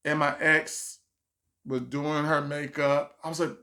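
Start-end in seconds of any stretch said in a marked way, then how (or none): none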